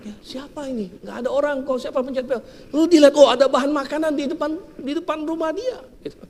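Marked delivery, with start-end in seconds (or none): 0.1-2.4 put-on high, soft, puzzled old woman. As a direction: wonder who rang the bell. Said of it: "Nenek ini keluar". (nenek yang bingung, suara tinggi dan pelan)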